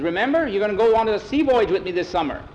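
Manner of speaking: rally a sick son briskly; hearty, hurried pep talk; rousing father. A narrator's reading.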